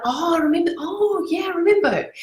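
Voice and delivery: high surprised recall; recognition. Retold or imitated. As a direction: voice rises high on remember. Voicing someone recalling.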